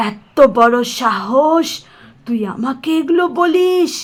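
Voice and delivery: slow, loud, outraged; menacing anger. Acted. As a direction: drag out the outrage as a threat. Vampire outraged at captive. (রাগে ধীরে গর্জন)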